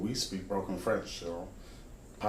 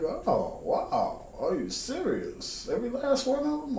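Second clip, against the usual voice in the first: surprised. Retold or imitated. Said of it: "I'm like".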